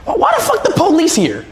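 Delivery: very high, laughing, fast outburst; shocked laughing disbelief. Real, not acted. Shocked that police arrived.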